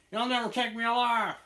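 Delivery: high, loud defiant holler; defiant outlaw yell. Acted. Host playing outlaw who won't surrender, defiant yell.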